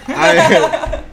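laughing through the words; cracking up mid-sentence. Real, not acted. Bursts laughing mid-intro.

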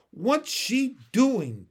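high, loud, drawn-out question; nosy schoolchild asking in amazement; curious onlooker. A narrator's reading. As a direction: ask it high and incredulous. Curious crowd of children asking.

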